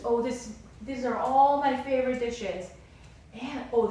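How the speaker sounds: loud, gushing over the food; pleased royal guest raving; delighted king. Retold or imitated. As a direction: savor every dish with loud delight.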